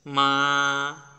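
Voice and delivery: মা drawn out on a level pitch; long, even-pitched মা; flat first tone. Shown as a demonstration. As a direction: take time over মা, no rise or fall. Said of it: "flat tone".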